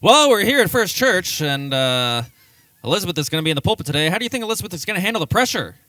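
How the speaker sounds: frantic mock sports commentary, loud and high; fast, loud, high play-by-play announcer; sportscaster voice. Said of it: "if you had to talk like a sportscaster".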